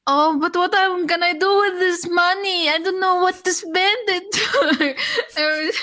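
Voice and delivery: high, slow, dopey put-on voice; imitating the guy flaunting his money; mocking the show-off. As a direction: drag it out like a clueless rich boy. Mocking the guy's money brag.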